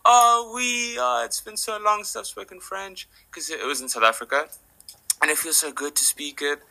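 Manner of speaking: a pleased foreigner suddenly warm and chatty; delighted French man. Retold or imitated. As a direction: gush with relief at hearing French. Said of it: "suddenly becomes this".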